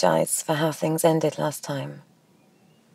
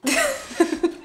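high-pitched amused laugh. Acted. Ex hears about the men's night, laughing.

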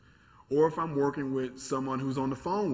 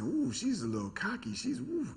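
impressed tease; 10 semitones higher, 3 dB quieter than usual. Retold or imitated. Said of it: "I'm saying".

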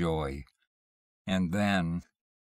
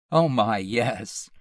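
laughing; 3 semitones higher, 6 dB louder, slower than usual. A narrator's reading.

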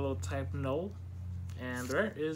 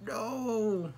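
dismayed shout; 8 semitones higher than usual. Real, not acted. Disappointing rare card pulled.

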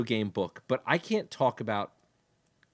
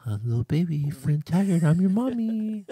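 higher, rising to a laugh; mock baby-talk Flynn; 6 semitones higher, 6 dB louder than usual. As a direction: coo it like a mother to a baby. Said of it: "he's a".

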